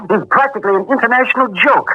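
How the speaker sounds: mocking laugh, light and amused; dismissive chuckle. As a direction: laugh the man off.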